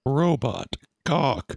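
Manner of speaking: slow robotic intonation. Acted. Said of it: "It's like Robot Rock".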